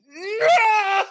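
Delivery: high laughing "No!"; laughing shout. Real, not acted. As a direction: yell it out through a laugh. Dismayed at own PogChamp slip.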